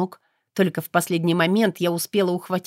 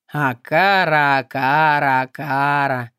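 slow, mocking drawn-out name; sarcastic sigh; 5 semitones lower, slower than usual. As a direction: exhale the name with scorn. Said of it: "саркастично выдохнул".

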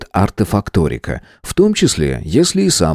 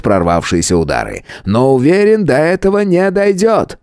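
higher showman announcer voice; ringmaster; 10 semitones higher than usual. Acted. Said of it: "конферансье".

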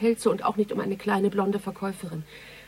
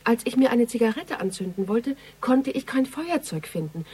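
ordinary talk

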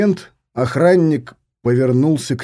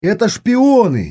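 nervous shout; 7 semitones higher than usual. Acted. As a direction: cry out in alarm. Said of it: "нервно выкрикнул Алденин".

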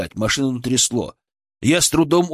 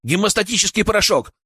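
urgent, higher-pitched question; shouted question; 9 semitones higher than usual. A narrator's reading. Medic asks colleague for haemostatic powder, calling out urgently. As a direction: call out for supplies. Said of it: "крикнул я".

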